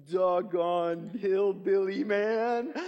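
loud, slow, drawn-out put-on insult; hammed-up harmless insult, stretched out; mock trash talk.

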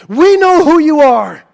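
high, loud, terrified shout; demons crying out. Acted. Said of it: "the demons would verbally recognize him".

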